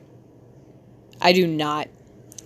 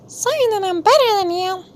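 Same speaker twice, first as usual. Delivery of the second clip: squeaky, much higher mock voice; high-pitched mocking; 10 semitones higher than usual. Repeats the boast in high voice.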